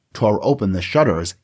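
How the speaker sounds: ordinary talk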